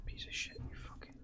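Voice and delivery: hushed, breathy swearing under the breath; cursing whisper. Real, not acted. Player hiding from the alien, whispered cursing. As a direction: curse without letting it hear you.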